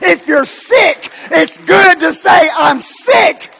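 rousing sermon crescendo, slowing at the peak; full-force preaching. Real, not acted. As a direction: pitch climbing to a high, insistent shout.

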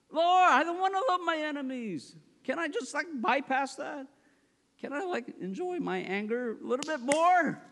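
high-pitched mock complaint to God; reluctant bargaining, played for comedy; protesting prayer. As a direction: wheedle for an exemption, pitched high. Acted reluctant bargaining with the Lord.

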